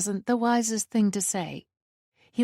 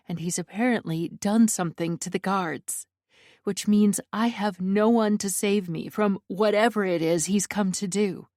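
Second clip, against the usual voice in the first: usual speaking voice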